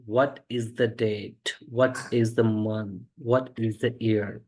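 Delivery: very slow, each word set apart; slow teacher's dictation pace. Shown as a demonstration. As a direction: read each question out slowly for learners. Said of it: "मिला करके बोल सकते हो what is को".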